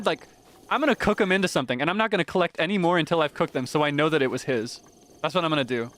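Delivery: ordinary delivery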